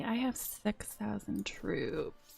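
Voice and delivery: usual speaking voice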